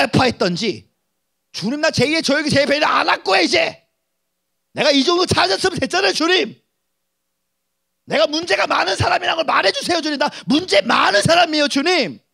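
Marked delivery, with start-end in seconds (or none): 1.5-12.2 high, loud pleading to God; desperate plea. Acted. Preacher pleading with the Lord to end his search, loud. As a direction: beg the Lord, near shouting.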